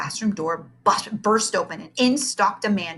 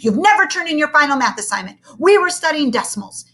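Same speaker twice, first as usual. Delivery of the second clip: stern teacher; 8 semitones higher, 6 dB louder than usual. Acted.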